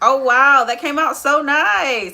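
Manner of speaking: high, loud delighted gasp; surprised delight. Real, not acted. Makeup artist thrilled by her own peel-off reveal. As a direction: squeal at how good it looks.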